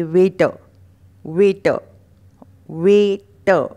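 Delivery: वेटर as "way-tuh", firmly repeated; silent r. Shown as a demonstration. Teacher drilling waiter, वेटर without r. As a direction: finish वेटर on "uh" instead of r. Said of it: "ट के बाद अ का उच्चारण".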